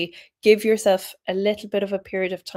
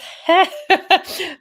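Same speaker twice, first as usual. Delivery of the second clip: short high burst of laughter; amused laugh; 11 semitones higher, 5 dB louder than usual. Real, not acted. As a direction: laugh at yourself mid-sentence. Laughs at her own rushing.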